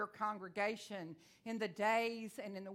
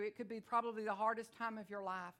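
ordinary talk

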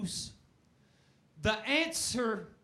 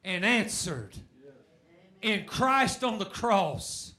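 normal talking voice

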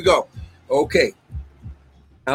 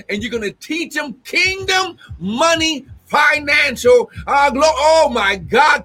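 raised pitch, swelling into loud exclamations; full-force prophetic preaching; 8 semitones higher, 4 dB louder than usual. Acted. Prophet telling the apostle of pulling people from debt, impassioned preaching. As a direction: build to an ecstatic preaching peak.

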